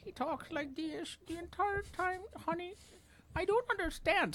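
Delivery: put-on very high, slow old lady voice; old lady impersonation. Prankster posing as an old lady to scammers, high old lady voice. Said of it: "pretends to be an old lady".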